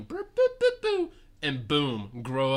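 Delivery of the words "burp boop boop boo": very high squeaky babble; grimmest tongue. Retold or imitated. The small aunts speaking their strange tongue, high and squeaky. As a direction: chirp the nonsense in tiny voices. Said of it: "speak the grimmest tongue".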